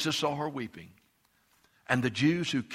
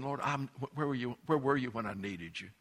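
softer, hurting plea to the Lord; pleading Martha; 8 dB quieter than usual. Acted. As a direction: quietly voice her broken question. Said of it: "It's like she's saying".

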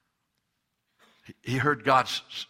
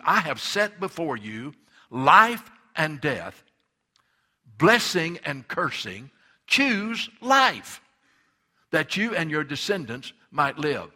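louder, higher, drawn-out pulpit cry; preaching at full force; 3 semitones higher, 6 dB louder, slower than usual. Acted. Preacher urging the congregation to choose life, fervent. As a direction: preach it like a call to decide.

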